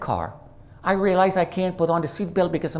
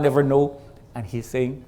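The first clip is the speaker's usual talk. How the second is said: ordinary delivery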